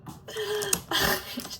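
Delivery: high squealing laugh; giggling at herself. Real, not acted. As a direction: burst into a giggle. Laughs at own answer.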